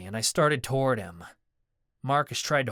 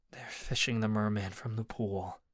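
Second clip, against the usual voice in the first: said lowly; 3 semitones lower, 7 dB quieter than usual. A narrator's reading. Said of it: "he said lowly".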